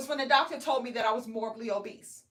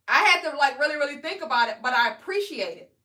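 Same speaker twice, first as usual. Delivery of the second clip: ordinary talk, nothing marked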